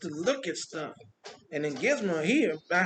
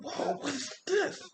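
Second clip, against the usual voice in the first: slow, high, hushed whoa of a crowd that can't believe it; stunned crowd; 8 semitones higher, 4 dB quieter, slower than usual. Retold or imitated. Crowd at the parade sees the frozen Iron Man, hushed amazement. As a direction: let it hang in soft amazement. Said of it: "They're like".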